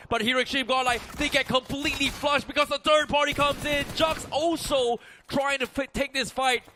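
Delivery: loud, rising hype play-by-play; esports caster. Real, not acted. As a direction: call the fight with building excitement. Caster calls sudden fight live.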